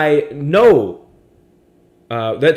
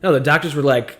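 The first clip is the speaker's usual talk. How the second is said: usual speaking voice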